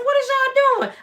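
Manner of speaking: a high, puzzled "what is y'all doing"; high mock boyfriend voice. The auntie's boyfriend catching the girls digging up the yard, high and puzzled. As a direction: squeak it out like a confused grown man.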